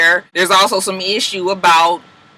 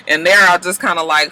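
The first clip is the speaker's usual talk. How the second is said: ordinary talk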